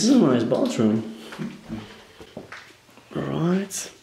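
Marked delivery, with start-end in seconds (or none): none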